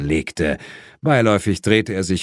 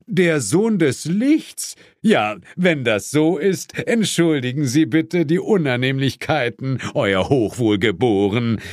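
mocking; 5 semitones higher than usual. A narrator's reading. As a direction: sneer the title back at him with mock politeness. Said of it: "verneigte sich spöttisch".

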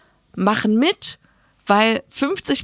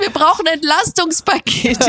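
mock demand; 5 semitones higher, 4 dB louder than usual.

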